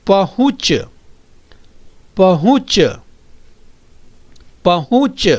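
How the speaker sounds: पहुंच with a nasal u, said clearly; careful pronunciation. Shown as a demonstration. Teacher drilling a chandrabindu word, clear nasal u. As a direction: model पहुंच for the class to echo. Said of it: "बोलिए".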